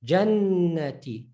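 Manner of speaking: Jannati slowly, with a long held N; doubled noon. Shown as a demonstration. Teacher reading the word with shadda, doubled noon. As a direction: dwell on the N in Jannati. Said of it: "You're gonna emphasize the noon".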